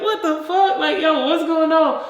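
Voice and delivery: high-pitched comic confusion; bewildered. Acted. Revived dead person asking the clapping family, bewildered. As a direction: squeak it out in mock disbelief.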